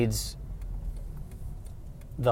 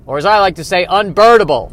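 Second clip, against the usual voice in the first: excited bird pun; 7 semitones higher, 10 dB louder than usual. Acted. Bird punning, building.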